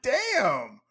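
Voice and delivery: high, loud, drawn-out "damn"; retold amazement. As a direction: relive your shock at a clean hit. Retelling own shocked reaction.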